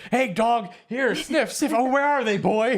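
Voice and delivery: high coaxing voice to a dog; talking to a dog. Retold or imitated. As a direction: call the dog like a pet owner. Mock cop talking to dog.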